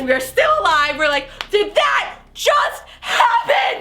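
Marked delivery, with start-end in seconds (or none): 1.5-3.8 high, slow, laughing cry; stunned laughing yell; disbelieving shout. Retold or imitated. Disbelief after escaping.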